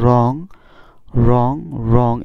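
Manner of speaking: রং repeated as a drill, each begun on R; silent W. Shown as a demonstration. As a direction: say রং with no W, pausing between repeats. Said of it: "আমরা কি উচ্চারণ করব?".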